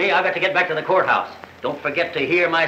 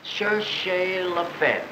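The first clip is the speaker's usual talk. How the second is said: slow, softer rehearsal; trying out the phrase; 6 dB quieter, much slower than usual. Acted. DA testing a line for his summation, slow and hushed. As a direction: savour the French words under your voice.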